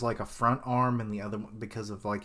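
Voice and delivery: ordinary delivery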